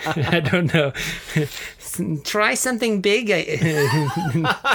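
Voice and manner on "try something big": high, pushy wife voice. Retold or imitated. Herodias pushing her husband, high put-on voice. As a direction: needle him upward in a high voice. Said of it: "You do that so well".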